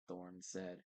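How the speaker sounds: usual speaking voice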